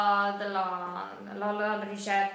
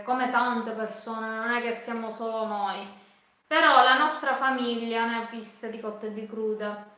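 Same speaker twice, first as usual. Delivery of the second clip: usual speaking voice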